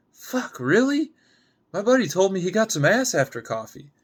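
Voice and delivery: can't believe he's turned down; a sharp, high protest at being refused; incredulous outburst. Acted. Date refused, Alan balks.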